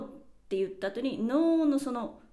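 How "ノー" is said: ノー stretched long, high and loud; long Spanish-style ノー trailing off; drawn-out no. Retold or imitated. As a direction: hold the ノー out while catching yourself. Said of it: "って伸ばしてる間に".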